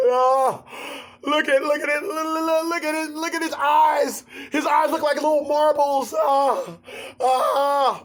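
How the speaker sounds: hammed-up teary panic over a dead cat; imitating a distraught woman. Woman crying over the cat she ran over, high hammed-up tearful plea. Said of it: "They start crying".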